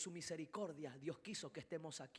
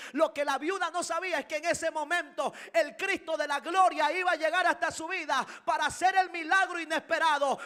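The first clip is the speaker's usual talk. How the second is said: building from raised voice to full-force high shout, easing at the end; fervent preaching; 9 semitones higher, 19 dB louder than usual. Real, not acted. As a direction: preach it louder and louder, driving each repetition. Prédica que crece hasta el grito. (prédica a gritos, con fervor creciente)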